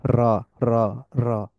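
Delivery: র rolled with an exaggerated trill; demonstrating an overdone rolled র. Shown as a demonstration. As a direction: roll the র hard to show the tap. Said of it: "আমি একটু exaggerate করে করতেছি বোঝানোর জন্য".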